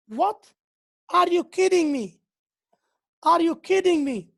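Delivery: what, are you kidding me, in real shock; shocked disbelief. Shown as a demonstration. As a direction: react as if you can't believe it. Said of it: "express shock or disbelief".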